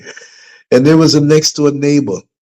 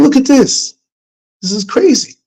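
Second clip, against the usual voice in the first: high, raised-voice mock outrage; angry disbelief; 13 semitones higher, 3 dB louder than usual. Father retelling his anger at the neighbors, high and raised. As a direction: vent it like you can't believe them. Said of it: "it made me angry".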